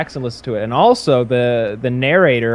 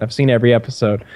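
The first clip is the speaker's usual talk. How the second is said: ordinary talk, nothing marked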